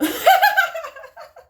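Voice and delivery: high, loud burst of laughter; big laugh. Real, not acted. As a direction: crack up at your own joke. Laughing at own accent.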